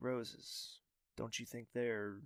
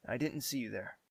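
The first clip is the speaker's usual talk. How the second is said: ordinary talk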